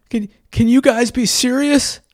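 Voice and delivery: put-on a panicked kid begging them to get serious; frantic and tearful. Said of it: "frantically and tearful".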